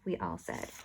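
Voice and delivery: ordinary delivery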